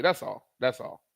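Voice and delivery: ordinary talk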